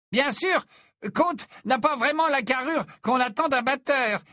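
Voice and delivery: voix haute et méprisante; ton hautain. A narrator's reading. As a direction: parle de haut, pincé et snob. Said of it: "dit Zacharias d'un ton hautain". (d'un ton hautain)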